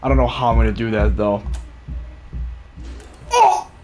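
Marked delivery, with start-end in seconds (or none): 3.3-3.7 sharp high startled cry; startled yelp. Real, not acted. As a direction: jump at the scare. Sudden jump scare.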